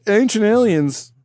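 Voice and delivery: "ancient aliens" slow and high, each word weighted; "ancient aliens" announced slowly as a grand title; spoken capital letters. Real, not acted. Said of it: "I can hear the capital letters".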